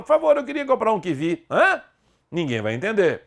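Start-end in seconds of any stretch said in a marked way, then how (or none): 0.0-1.4 higher, quick customer asking at a stall, "kivi"; mock shopper. Teacher acting a shopper at a fruit stall, higher and quick. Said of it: "Saia pelas feiras e supermercados". 1.5-1.8 sharp high "Hã?" of confusion; a seller who didn't catch it; baffled vendor. Pretend. Market seller confused by the word, high puzzled "Hã?".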